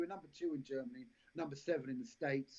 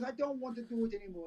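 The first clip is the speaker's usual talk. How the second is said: higher, lightly put-on Jamaican lilt; a rough imitation of a seventy-year-old Jamaican boss; crap Jamaican accent; 7 semitones higher than usual. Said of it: "She's my crap Jamaican accent".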